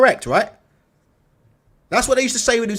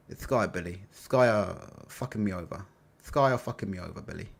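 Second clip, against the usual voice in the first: much softer, lower grovelling impression of Ben Shalom; mock Ben Shalom; 8 semitones lower, 10 dB quieter than usual. Promoter grovelling to the boxer on the phone, soft and low. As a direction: plead quietly like a begging promoter. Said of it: "I'm not a very good impersonator".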